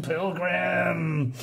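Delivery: a strange altered voice calling the name; eerie call. Acted. Dead horse calls the pilgrim in an eerie voice.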